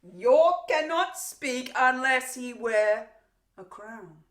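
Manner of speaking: big theatrical sneer; mocking proclamation. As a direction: proclaim it grandly to ridicule a captive. Mock proclamation for captive.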